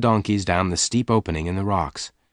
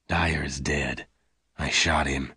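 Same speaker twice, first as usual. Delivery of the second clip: low, slow, soft man's voice; grave Lassiter; 6 semitones lower, 5 dB quieter, slower than usual. A narrator's reading. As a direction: state it gravely and deep, unhurried. Lassiter grimly reports a killing.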